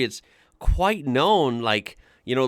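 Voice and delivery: ordinary talk, nothing marked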